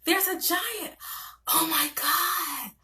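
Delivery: breathless storybook awe, a touch tearful at the end; animated storytelling. Shown as a demonstration. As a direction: gasp it out like reading to a wide-eyed child. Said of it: "I'm very animated, particularly for my young kids".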